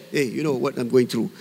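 low, soft, casual confiding; real, open talk. Acted. Friend sharing troubles with another, low and open. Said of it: "we are so real, so open".

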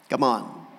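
usual speaking voice

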